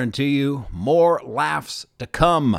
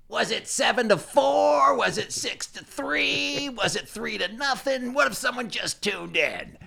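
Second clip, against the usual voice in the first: higher, drawn-out string of questions; mock questioning listeners; 9 semitones higher, slower than usual. Announcer voices puzzled listeners wanting the score, mock questioning. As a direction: plead for the score, pitched up and stretched out.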